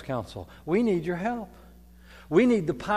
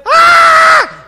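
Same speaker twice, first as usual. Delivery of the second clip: a sudden high piercing yelled Ah, much louder; imitated child scream; 17 dB louder than usual. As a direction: shriek it like a kid in an echo chamber. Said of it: "So when someone went".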